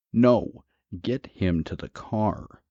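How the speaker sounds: acted forceful command; urgent order. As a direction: cut in loudly, then spell out the order slowly. Husband urgently orders his wife.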